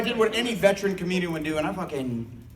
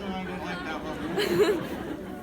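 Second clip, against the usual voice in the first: snooty audience member; 6 semitones higher, much slower than usual. Retold or imitated. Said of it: "when somebody's like".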